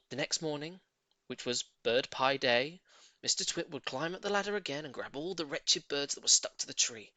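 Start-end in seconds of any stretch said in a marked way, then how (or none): none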